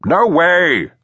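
loud, high refusal; calling. A narrator's reading. Manor voice refusing the swimmers' request, calling. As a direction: call it out from far away. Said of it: "the voice called".

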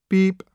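high-pitched imitated electronic beep; phone beep. A narrator's reading. Phone answering as its button is pushed, imitated beep. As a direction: beep like a machine, high and sudden. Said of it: "said the phone".

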